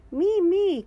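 high, loud little rabbit cry; eager cry. A narrator's reading. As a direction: beg to be picked. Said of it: "cries Rebecca Rabbit".

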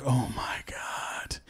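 slow, hushed retold amazement; awed whisper. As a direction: whisper it slowly, as if overwhelmed. Retold awed reaction to invitation.

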